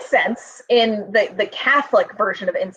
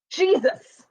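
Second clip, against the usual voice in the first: startled Jesus slipping out higher; a higher exclaimed oath; startled exclamation; 8 semitones higher than usual. Retold or imitated. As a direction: blurt Jesus higher in surprise. Blurts exclamation to priest.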